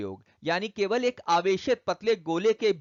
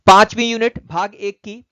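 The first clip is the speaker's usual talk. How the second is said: louder announcement of the new unit; loud heading; 3 semitones higher, 13 dB louder than usual. Real, not acted. Teacher announcing the next unit to students, loudly. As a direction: call out the unit title to the class.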